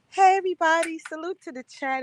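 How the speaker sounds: lightly voiced imitation of Arabia's greeting; a hint of a friend's chat greeting; repeating a friend's salute to the chat, lightly voiced. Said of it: "in Arabia's voice".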